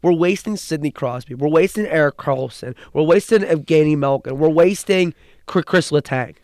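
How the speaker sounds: normal talking voice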